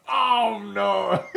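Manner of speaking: high, loud "Oh, no!" with a laugh; loud exclamation. Retold or imitated. Trainer spots the dog's mess, loud dismayed cry. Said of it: "He made an exclamation".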